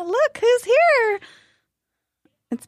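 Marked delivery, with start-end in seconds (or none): 0.0-1.2 sing-song greeting. Real, not acted. Greeting arriving guest.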